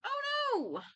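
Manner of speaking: high, startled cry of dismay; oh no, said high and alarmed; alarmed gasp. Acted. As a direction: jump up in pitch in sudden worry. Hearing friend is missing.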